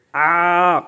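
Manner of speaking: آ held for one alif; prolonged aa as in recitation; long drawn aa. Shown as a demonstration. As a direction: stretch the آ out evenly. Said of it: "تو آپ پڑھیں گے".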